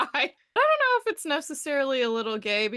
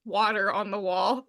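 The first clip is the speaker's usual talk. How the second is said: ordinary talk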